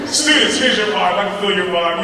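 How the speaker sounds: grab the students' attention; calling out. Acted.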